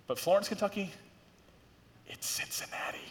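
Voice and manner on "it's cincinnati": conspiratorial whisper aside; stage whisper. Pretend. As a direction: whisper it like a secret. Secret aside, don't tell them.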